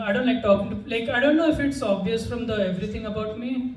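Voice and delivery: ordinary talk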